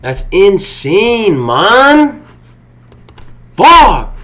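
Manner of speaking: high, loud yells that stretch the words out; furious outburst. Real, not acted. Trader raging that he should have hit, furious outburst. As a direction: vent the rage at the missed target. Missed profit again.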